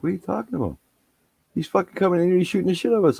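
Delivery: very high mock grievance; imitated complaining friend. Dad imitating his son's friends complaining to him, high protest. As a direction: tattle in a high, exasperated voice.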